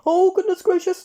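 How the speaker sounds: a fussy little man crying out in fright; high panicked cry. A narrator's reading. Frightened Mr Jelly crying out over crackling cereal, high and loud. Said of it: "cried Mister Jelly".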